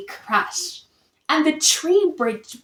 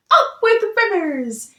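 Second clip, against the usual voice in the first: much higher joyful hoot; happy hoot; 8 semitones higher, 3 dB louder than usual. A narrator's reading. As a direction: hoot with delight. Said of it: "And they hooted".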